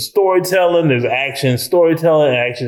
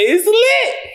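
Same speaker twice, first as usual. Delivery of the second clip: high-pitched imitated catchphrase. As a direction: throw it out as a high hype call. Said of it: "I thought he was gonna say".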